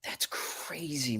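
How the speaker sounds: breathy slow whisper; slow awed whisper. Real, not acted. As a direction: hush it in disbelief. Reacting in disbelief.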